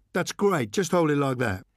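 high, coaxing photographer voice; cheerful press photographer calling to Thorne; eager photographer. Acted. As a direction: call out to grab his attention.

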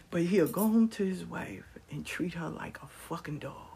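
conspiratorial whispered aside. Real, not acted. Telling the audience about the husband, hushed whisper. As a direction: drop to a whisper like sharing a secret. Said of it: "whispered aside".

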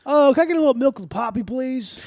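high, quick mock plea; hammed-up little request; mock pleading. Mock patient begging for poppy milk.

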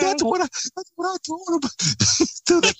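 words squeezed out through laughter; laughing. Real, not acted. Still laughing hard at his story while telling the host, high and strained. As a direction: speak through a laughing fit.

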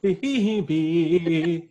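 high, loud pump-up yell; practice yell. Shown as a demonstration. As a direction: belt the dog's name out high and loud. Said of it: "I was practicing my yell".

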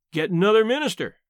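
usual speaking voice

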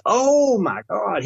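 high, slow, drawn-out amazement; amazed. Retold or imitated. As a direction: stretch it out in disbelief. Orderly learns who he is.

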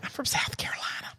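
whispered confession; whispering. Real, not acted. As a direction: whisper it aside. Whispered aside.

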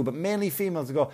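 usual speaking voice

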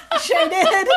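loud, high laughing talk; snorting laughs. Real, not acted. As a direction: still cracking up. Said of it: "did I just snort".

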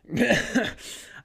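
high, sudden laugh at his own joke; burst of laughter. Real, not acted. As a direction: break out laughing.

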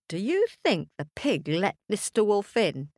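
plain reading voice

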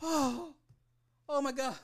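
high-pitched, gasped-out disbelief; shocked reaction. Retold or imitated. Viewer reliving the murder scene, high-pitched horrified exclamations. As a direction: reenact being floored, voice way up high. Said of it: "I was like".

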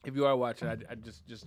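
ordinary talk

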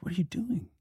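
voiceless whisper to himself; whispered thought. Retold or imitated. Actor across the poker table, whispering in disbelief. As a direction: whisper it under your breath, puzzled.

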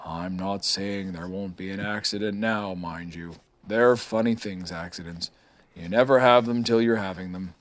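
a hint of a whisper; whispered back. A narrator's reading. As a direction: murmur it like a confidence. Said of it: "And Eeyore whispered back".

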